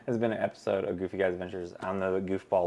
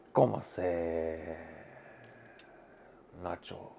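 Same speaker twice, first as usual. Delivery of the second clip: 1 slow mock Spanish accent; 3 semitones lower, 11 dB quieter, slower than usual. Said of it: "I think it sounds better when I talk like that".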